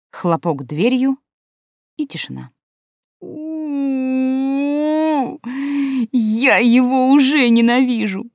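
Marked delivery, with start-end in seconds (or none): 3.2-8.3 whiny sobbing, higher. Acted. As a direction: wail it through tears. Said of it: "рыдала я со вкусом".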